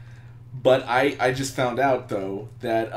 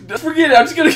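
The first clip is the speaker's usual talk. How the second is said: higher and louder, giggling through it; laughing; 7 dB louder, much faster than usual. Real, not acted. Giving up on the jump, still laughing. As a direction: toss it off mid-giggle.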